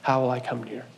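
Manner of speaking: soft, low, longing repeated question; God longing, hushed. Acted. God longing for his rebellious people, soft and low. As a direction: murmur it twice, aching and hushed. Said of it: "he keeps going".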